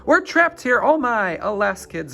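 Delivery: panicked scream. Acted. Teacher to her class after the footbridge collapses, panicked scream. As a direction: shriek like the bridge just fell away. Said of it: "started to scream".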